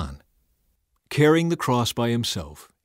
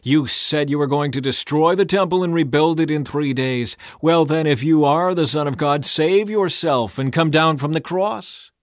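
loud, higher taunting; mocking shout; 6 semitones higher, 7 dB louder than usual. A narrator's reading. Passers-by taunting Jesus, loud mocking shout. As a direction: taunt him loudly, daring him. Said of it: "shouted abuse".

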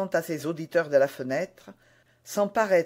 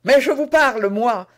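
loud, much higher, furious retort; loud high angry cry; exasperated shout; 7 semitones higher, 10 dB louder than usual. A narrator's reading. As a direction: snap back in outrage. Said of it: "s'écria le jeune homme exaspéré".

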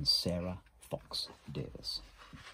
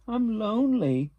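high, loud plaintive call; storybook wail of loneliness; lonely little beaver; 13 semitones higher, 9 dB louder than usual. A narrator's reading. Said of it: "he said".

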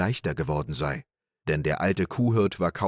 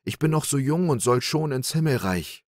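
higher, self-pitying plea; mock-pitiful lament; 7 semitones higher than usual. Little Klaus pretending to the cowherd, pitifully. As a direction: play the poor young victim. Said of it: "seufzte der kleine Klaus".

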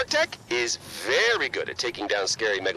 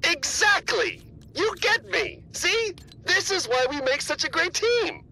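excited. Acted. Excited agreement with partner.

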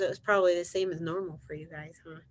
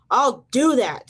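loud, higher boastful retort; grouchy ladybug; 6 semitones higher, 10 dB louder, slower than usual. Acted. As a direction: blurt it as a huffy storybook grouch. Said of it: "said the grouchy ladybug".

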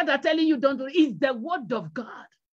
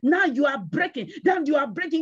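normal talking voice

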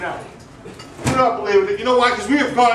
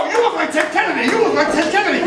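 mock excited fans; 7 semitones higher than usual. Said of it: "wherever I go".

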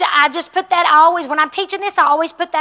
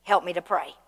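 quiet prayer; 6 semitones lower, 8 dB quieter than usual. Retold or imitated. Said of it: "I say".

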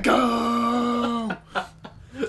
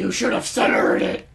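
scolding raspy Bat Dad voice. Acted. Friend scolds teammate over Rocket League, Bat Dad voice. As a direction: scold like a rasping superhero dad. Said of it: "I was doing Bat Dad".

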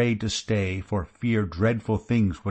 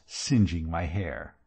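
ordinary delivery, nothing marked

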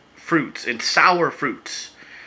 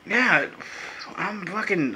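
usual speaking voice

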